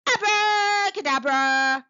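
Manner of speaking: high, loud, drawn-out incantation; shouted spell. A narrator's reading. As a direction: belt out the magic word, very stretched. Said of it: "waved her wand and shouted".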